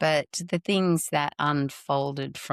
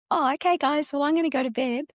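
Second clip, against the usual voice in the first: brushing it off; 10 semitones higher, faster than usual. Retold or imitated. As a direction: pitch it way up and pretend all is fine. Frozen mother retreats from son.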